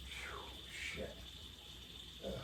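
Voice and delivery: whispered curse. Real, not acted. Frustrated muttering while working.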